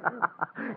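short cruel chuckle; gloating laugh. Acted. Man mocking his bound fiancée with a cruel laugh. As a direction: laugh at her helplessness. Killer gloats over victim.

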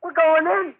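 high, frightened calls for help; panicked cry. Acted. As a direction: cry out as you are dragged in. Boys sucked into alien ship.

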